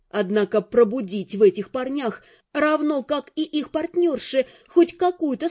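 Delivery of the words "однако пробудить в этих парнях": ordinary delivery, nothing marked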